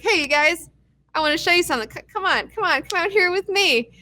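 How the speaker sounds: scheming Gavin lure. Retold or imitated. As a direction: sweet-talk them toward a trap. Gavin luring team outside.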